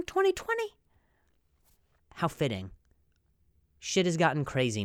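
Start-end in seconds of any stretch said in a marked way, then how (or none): 0.0-0.7 high squeak. Pretend.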